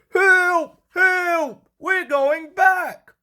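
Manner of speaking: high, loud panicked wail; Henry pushed backwards by the elephant, wailing. A narrator's reading. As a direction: cry out in terror. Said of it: "wailed Henry".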